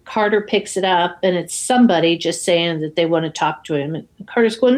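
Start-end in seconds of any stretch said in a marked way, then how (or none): none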